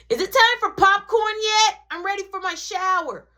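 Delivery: high, loud little-brother voice; kid brother voice. Retold or imitated. Little brother asking his sibling, high kid voice. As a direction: chirp it like an eager little kid.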